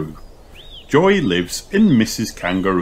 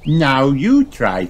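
put-on higher, drawn-out child voice; slow piglet invitation, pitched up; Peppa; 6 semitones higher, 4 dB louder, slower than usual. A narrator's reading. Peppa invites Kylie to jump, high-voiced. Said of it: "she says".

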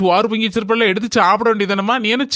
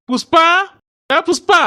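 high, raised call for someone in the house; 8 semitones higher, 4 dB louder, slower than usual. Acted. Husband shouting for his missing wife Pushpa, calling out. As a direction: call out for your wife, voice lifting at the end.

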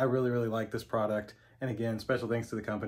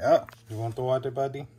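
pet talk; 3 semitones higher, 6 dB louder than usual. Real, not acted. Coaxing Buddy.

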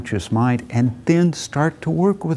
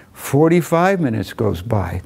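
usual speaking voice

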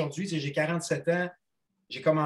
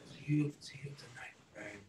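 embarrassed whisper; 11 dB quieter than usual. Retold or imitated. Embarrassed goodbye to idol.

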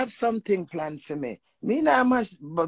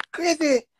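mock yell; 10 semitones higher, 5 dB louder than usual. Retelling a heated quarrel.

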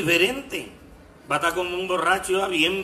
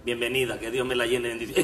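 mock funeral prayer for the treasurer; mock prayer leader; 6 semitones lower than usual. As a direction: pray over her like a funeral rezandero. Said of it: "soy rezandero".

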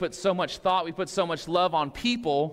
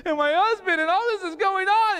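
mock breakdown; 8 semitones higher than usual. Pastor imitating a friend breaking down, mock tearful wail. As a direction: act out falling apart, pitch rising to a wail. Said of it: "that's normally your cue to cry".

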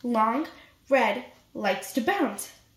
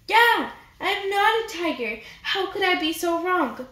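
excited revelation; 6 semitones higher than usual. Acted. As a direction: announce the discovery with glee. Mouse's comic revelation.

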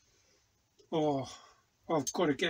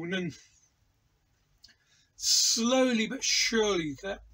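usual speaking voice

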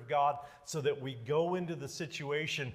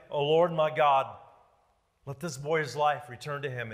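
usual speaking voice

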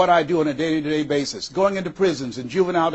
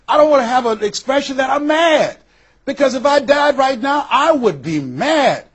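a hint of an indignant friend, higher and louder; animated friend; 8 semitones higher, 5 dB louder than usual. Friend Rosia protesting she'd die mad, higher and louder. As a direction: lift the voice as the fed-up friend.

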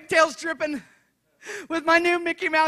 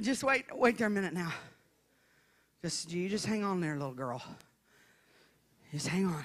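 low, hushed fatherly reassurance; calm daddy; 6 semitones lower, 8 dB quieter than usual. Retold or imitated. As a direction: soothe a little girl softly. Acts her father calming the child.

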